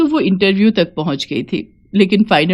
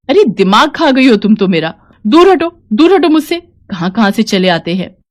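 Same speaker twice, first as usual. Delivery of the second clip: angry scolding; 3 semitones higher, 4 dB louder than usual. Acted. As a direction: drive the pest away with a sharp scolding. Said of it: "किसी औरत को डांट रही है".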